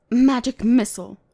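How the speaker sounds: loud battle cry of the spell; spell shout. Acted. Mage casting at the gnolls, loud spell shout. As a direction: cast it with force.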